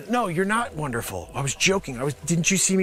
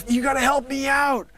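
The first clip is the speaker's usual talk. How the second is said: ordinary talk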